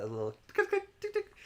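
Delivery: high squeaky tick tick tick like a toy clicker; clicker imitation. Shown as a demonstration. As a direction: mimic the clicker's ticking sound. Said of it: "It sounded just like that".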